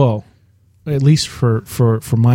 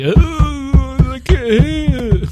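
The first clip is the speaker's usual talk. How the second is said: mock whiny; 12 semitones higher, much slower than usual. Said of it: "They'll be all like".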